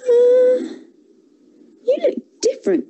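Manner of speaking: put-on high, fast child's voice; puzzled little girl asking. A narrator's reading.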